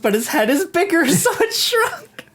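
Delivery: high giggly laugh through words. Real, not acted. Laughing at bad prosthetics.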